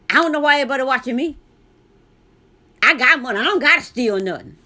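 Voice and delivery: loud and fast, speaking to the whole store; sassy loud complaint so the watchers hear it; loud mouthing off. Retold or imitated. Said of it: "I just go talking like that".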